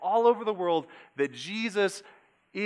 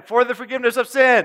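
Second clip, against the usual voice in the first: louder shout; 6 semitones higher, 9 dB louder than usual. Shown as a demonstration. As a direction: call it out loud. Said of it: "try it again".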